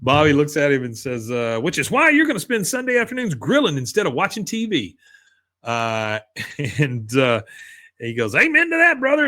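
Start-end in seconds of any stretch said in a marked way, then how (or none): 1.6-5.0 imitated high-pitched sales patter; put-on pitch, voice raised high; salesman's pitch. Acted salesman delivering his pitch. 8.3-9.3 high, loud mock whoop of approval; hearty agreement. Acted customer hearty agreement.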